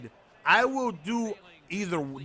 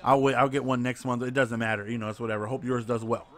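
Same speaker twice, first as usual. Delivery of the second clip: sarcastic; 6 semitones lower than usual. Retold or imitated. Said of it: "I started being sarcastic".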